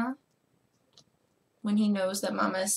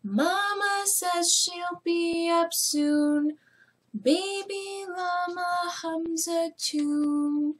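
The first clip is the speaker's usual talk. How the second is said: lilting storybook reading; reading to children; 6 semitones higher, much slower than usual. Acted. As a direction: read it like bedtime story time. Reads the story aloud to children.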